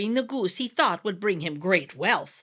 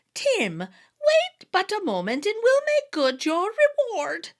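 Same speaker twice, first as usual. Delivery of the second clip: much higher, slow sly fairy voice; sly little man; 10 semitones higher, 5 dB louder than usual. A narrator's reading. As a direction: coax him with a crafty smile. Sly fairy leader promising.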